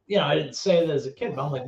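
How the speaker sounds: ordinary talk, nothing marked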